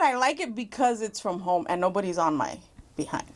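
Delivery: ordinary talk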